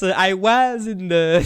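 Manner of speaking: slow, drawn out "was" in a put-on American accent; lightly hammed American accent; mock American. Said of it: "Y a aucun Américain qui dit yes".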